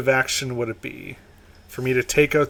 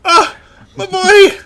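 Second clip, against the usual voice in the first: overjoyed father voice; 8 dB louder, slower than usual. Acted. NPC father sees son in jar.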